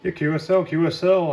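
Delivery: ordinary delivery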